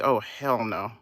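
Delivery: low, soft imitation of disgruntled customers; understated mimicked refusal, low and soft; low-key mock disbelief. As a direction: imitate their refusal under your breath. Voices the waiting customers' reaction.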